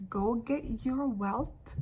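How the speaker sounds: "Go get your wealth" slowed word by word; slow emphasis. Real, not acted. Urging listeners to reclaim wealth, slow and emphatic. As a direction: spell out "your wealth" slowly, ending on a rise. Repeats command slowly for emphasis.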